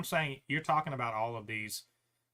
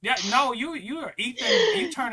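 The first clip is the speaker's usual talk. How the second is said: high, loud outburst at a critic; heated rant; 13 semitones higher, 10 dB louder than usual. Real, not acted. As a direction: let it boil over, calling him out. Ranting at a sideline critic.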